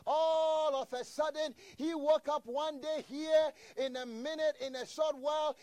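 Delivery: fervent preacher lifting the congregation; preaching at full force. Acted. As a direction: build it up like a revival preacher. Preaching climax.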